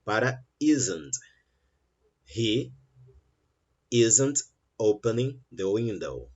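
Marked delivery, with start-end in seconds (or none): 2.2-6.3 slow, every word spelled out; slow full sentence. Shown as a demonstration. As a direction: say it slowly and carefully. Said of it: "Você consegue falar uma frase com menos tempo".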